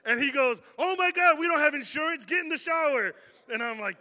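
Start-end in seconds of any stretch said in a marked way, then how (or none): 0.8-3.1 high, loud alarmed father barking orders; dad in a panic, snapping orders; panicked dad. Retold or imitated.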